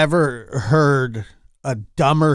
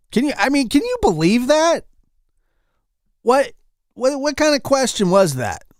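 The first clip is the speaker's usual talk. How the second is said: incredulous; 10 semitones higher than usual. Real, not acted. Outraged at reporter's question.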